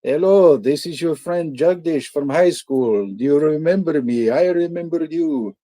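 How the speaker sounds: high, loud put-on voice of a chirpy caller; mock cheerful stranger calling out of nowhere; caller impression. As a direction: play the overly friendly old classmate on the phone. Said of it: "he goes".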